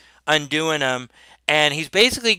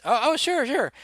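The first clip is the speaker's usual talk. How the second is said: timid Gilligan; 8 semitones higher, 5 dB quieter than usual. Acted. Gilligan answers nervously.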